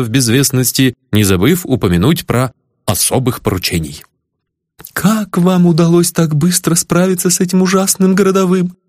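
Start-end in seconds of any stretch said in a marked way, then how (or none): 4.9-8.8 put-on high admiring girlish voice; admiring young lady. A narrator's reading. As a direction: ask breathlessly, in awe. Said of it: "спросила барышня".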